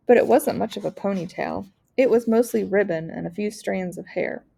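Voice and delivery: ordinary delivery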